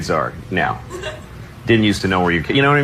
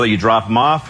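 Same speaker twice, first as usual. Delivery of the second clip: usual speaking voice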